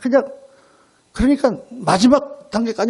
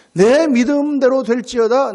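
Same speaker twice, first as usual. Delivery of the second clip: slow, raised preaching delivery; emphatic proclamation; 4 semitones higher, 4 dB louder, slower than usual. Retold or imitated. Preacher proclaims Jesus's words to the congregation, slow and emphatic. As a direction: stretch it out high and forceful.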